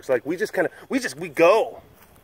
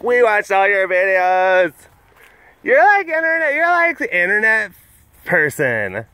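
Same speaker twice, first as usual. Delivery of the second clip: higher, bright-eyed fan voice; mimicking young fans; 6 semitones higher than usual. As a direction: imitate excited college kids. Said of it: "they're like".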